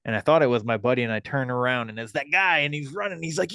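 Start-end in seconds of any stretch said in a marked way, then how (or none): none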